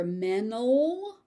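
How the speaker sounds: "mental" as MEN-ul, no T at all; dropped T. Shown as a demonstration. Teacher showing what learners may hear, "mental" without the T. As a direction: let "mental" run from N straight to the vowel. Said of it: "the T is dropped completely".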